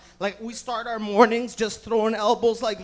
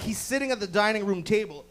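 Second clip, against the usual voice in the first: ordinary delivery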